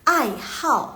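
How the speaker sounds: "ai hao" with HAO falling sharply; fourth tone on hao. Shown as a demonstration. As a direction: drop both AI and HAO in firm falling tones. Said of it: "bacanya jadi nada keempat". ("ai hao", HAO turun tegas nada empat)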